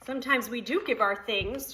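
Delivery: usual speaking voice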